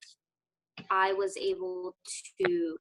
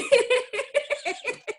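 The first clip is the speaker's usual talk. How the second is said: high giggle; 5 dB louder than usual. Real, not acted. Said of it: "[name] sipping on her wine".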